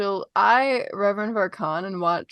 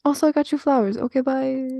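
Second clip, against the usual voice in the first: higher-pitched, hurried-away goodbye; flustered blurt; 5 semitones higher, faster than usual. Acted. Wythe offers flowers and tries to leave, flustered blurt. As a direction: blurt it and bail. Flustered in-character gift and exit.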